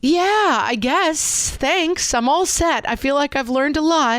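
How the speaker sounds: high-pitched, sarcastic gratitude; wry mock thanks. Mother to the idea of kids as teachers, wry mock thanks.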